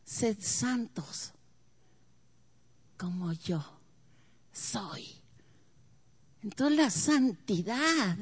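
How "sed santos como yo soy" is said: very soft and slow, dropping away to almost nothing; hushed solemn quote. Acted. Preacher softly quoting God's call to holiness, hushed and slow. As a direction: let God's words fall softly and slowly.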